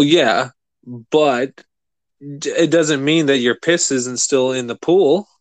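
ordinary talk, nothing marked

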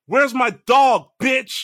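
high, loud, drawn-out holler; retold yell. Dreamer yells up at friend's mom from the street, retold holler. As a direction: yell it up at her, reenacting the dream. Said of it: "I'm yelling for my dog".

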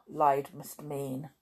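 ordinary delivery

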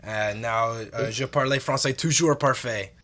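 English speaker's halting French; haltingly speaking French with an anglophone accent; foreign accent in french. Pretend. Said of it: "I can't say that in French".

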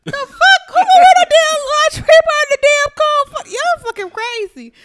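loud, high-pitched disbelief; real laughing disbelief, voice way up; incredulous outburst. Real, not acted. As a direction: shout it out, appalled and amused. Reacting to December lingerie party story.

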